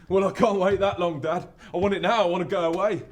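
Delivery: loud, high outburst at dad; impatient demand. Acted. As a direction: demand it like a son who won't wait. Son demands money from his dad.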